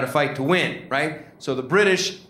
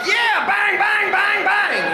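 shooting shouts; 4 dB louder, slower than usual. Retold or imitated. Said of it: "they're like".

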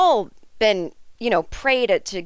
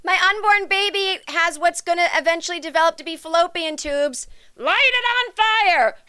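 a higher, proud parent ending in a shrill cry; mock the parent who burns the woods; hammed-up proud parent yelling light it on fire; mocking gender-reveal parent; 7 semitones higher than usual.

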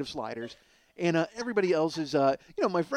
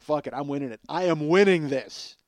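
determined declaration; 6 dB louder than usual. Retold or imitated. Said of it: "So I was like".

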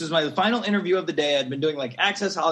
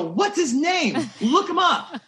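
excited demand; 11 semitones higher, 3 dB louder, slower than usual. Retold or imitated. Retold excited demand to publicist.

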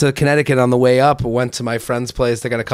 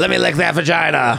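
cartoon pirate voice. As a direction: play a bawdy pirate. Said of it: "they could do pirate".

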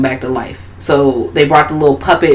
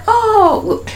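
squeaky oh. Retold or imitated. Voicing character.

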